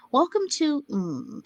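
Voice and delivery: slow, grand announcer welcome. Pretend. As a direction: open it like a show host. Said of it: "I'm gonna read this in my best announcer voice".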